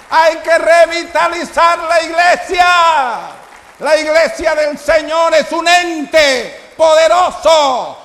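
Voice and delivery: high, loud, slow preaching shout; preaching at full force. Acted. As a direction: proclaim it to the congregation, full voice. Predicación a toda voz. (predicación a gritos, aguda y lenta)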